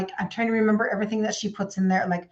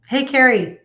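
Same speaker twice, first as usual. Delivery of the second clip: calling out; 3 semitones higher, 6 dB louder than usual. Real, not acted. Calling to Carrie off camera.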